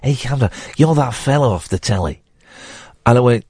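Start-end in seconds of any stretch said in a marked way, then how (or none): 0.0-2.2 hesitant mock Scouse impression; rough go at Liverpudlian; attempted Liverpool accent. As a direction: have a stab at a Liverpool accent, imitating a local woman. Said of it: "Is that a Liverpool accent?".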